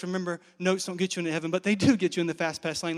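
ordinary talk, nothing marked

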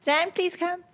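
high, small begging voice; pleading. Acted. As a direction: coax a friend along with a high-pitched plea. Oriole begs companion to come.

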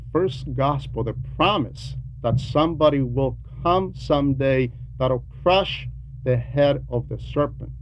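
ordinary talk, nothing marked

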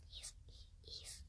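hushed whispered aside; whispered apology. Real, not acted. Child apologizing for parent's accent, whispered. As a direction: whisper it very softly.

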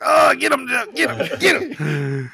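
high excited shout, urging Joe on; cheering him on. Retold or imitated. Cheering Joe on from the couch, shouting. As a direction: yell at the TV like a fan. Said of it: "And I'm just like".

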